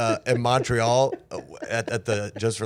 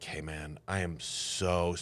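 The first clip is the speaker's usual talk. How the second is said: much softer, slow, sheepish; sheepish apology; 5 semitones lower, 9 dB quieter, slower than usual. Retold or imitated. Apologizing to Damon afterward, soft and sheepish. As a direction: mumble it like you're embarrassed. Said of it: "I was like".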